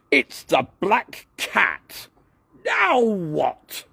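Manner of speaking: shouted. A narrator's reading. Douglas shouting his scene line on camera, high and slow. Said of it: "shouted Douglas".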